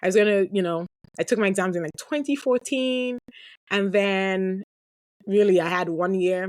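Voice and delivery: ordinary talk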